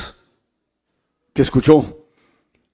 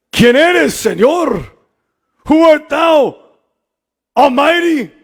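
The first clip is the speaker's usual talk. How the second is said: Saul calling out; 8 semitones higher, much slower than usual. Acted. Preacher voicing Saul, raised.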